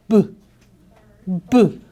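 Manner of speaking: b, b with lips pressed shut; closed consonant sound. Shown as a demonstration. Teacher showing children how b closes the mouth. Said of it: "what happens to our mouth?".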